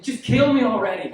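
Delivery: mock-despairing cry to be put out of misery; exasperated plea. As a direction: groan it out loudly in embarrassment. Acted inner cry of embarrassment.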